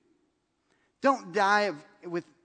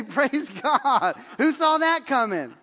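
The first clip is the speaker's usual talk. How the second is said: laughing praise; 4 semitones higher, 7 dB louder, slower than usual. Pretend. Cheerleader scores winning touchdown.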